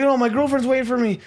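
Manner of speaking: high, mock-tearful pleading; fake tears. Drunk traveler begs staff to board, high fake-tearful plea. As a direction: imitate a drunk laying on a sob story. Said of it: "fake tears".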